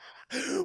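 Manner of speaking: high, wheezy burst of laughter; laughing. Real, not acted. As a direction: keep cracking up helplessly. Cracks up at own reveal.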